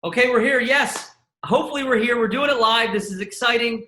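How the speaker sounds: high, bright-eyed host welcome; excited opening. Real, not acted. Host greeting the live audience with excitement. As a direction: kick off the live show with a burst of energy.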